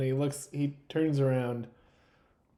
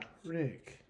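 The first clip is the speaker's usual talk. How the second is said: put-on soft creepy old-movie voice; softly creepy, like a forties film weasel; Peter Lorre; 3 semitones higher, 10 dB quieter than usual. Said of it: "Peter Lorre was Ugarte?".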